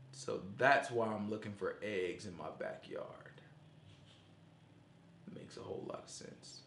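dry sarcasm, trailing into a whisper; deadpan sarcastic aside. Acted. Sarcastic jab at egg hunts.

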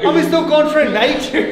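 high, loud, exaggerated British accent; bad British accent. Retrying after friend called it horrible, loud mock British accent. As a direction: shout it in a hammed-up British accent. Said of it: "Say it in a British accent".

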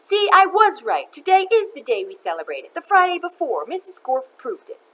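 put-on high, loud kid's vindication; triumphant. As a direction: crow that you were right all along. Stephen leaps up, vindicated.

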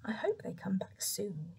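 soft, breathy-free whispered wish; a sighing whisper of longing; sighed whisper. A narrator's reading. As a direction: whisper it with a sigh. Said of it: "he sighed".

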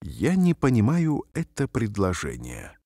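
normal talking voice

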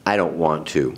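low, subdued refusal; hurt. Acted. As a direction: decline in a small, hurt way. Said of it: "Her feelings were too hurt".

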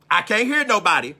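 ordinary talk, nothing marked

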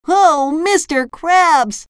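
very high, drawn-out gushing exclamation; SpongeBob. A narrator's reading. Overjoyed fry cook to his boss, exclaiming. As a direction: gush slowly in a squeaky cartoon voice. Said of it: "SpongeBob exclaimed".

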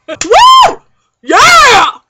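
loud, high whoop; celebratory cheer. Acted. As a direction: whoop it out in triumph. Celebrating not having to host anyone.

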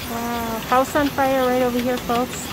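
ordinary talk

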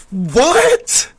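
high, loud disbelieving "What?"; incredulous. Real, not acted. Reacting to the clip, he shouts in high disbelief.

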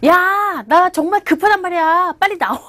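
mock urgent hurry-up call; impatient urging. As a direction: press someone who won't come out. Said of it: "뭔가를 재촉할 때".

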